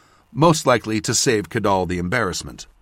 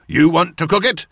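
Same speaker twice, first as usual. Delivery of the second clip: menacing question, higher and louder; 7 semitones higher, 5 dB louder than usual. A narrator's reading. Torum challenging his brother over the pan, menacing. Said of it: "Torum asked menacingly".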